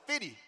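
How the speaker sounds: high "fifty." said as "fiddy"; Saint Louis fifty. Retold or imitated. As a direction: repeat "fifty." as "fiddy" to the agent. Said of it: "I be like".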